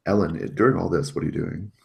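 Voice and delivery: ordinary talk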